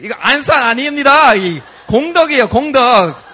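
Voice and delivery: loud, high, fast retold shout; hammed-up insistent yelling; exasperated correction. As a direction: call it out to someone disoriented. Correcting the confused girl, acted.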